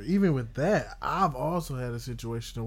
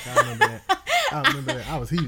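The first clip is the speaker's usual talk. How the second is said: laughing; 4 semitones higher, 6 dB louder, much faster than usual. Real, not acted. Friend laughing, recalling being woken.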